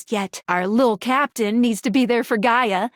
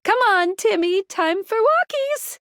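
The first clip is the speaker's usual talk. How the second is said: high singsong pet-owner call; 8 semitones higher than usual. Acted. Calling Timmy out for a walk, high pet-owner coaxing. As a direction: coax a dog out for walkies.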